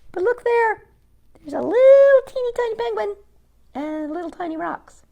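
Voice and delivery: high, cooing delight at something tiny. Acted. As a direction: coo over the teeny tiny penguin.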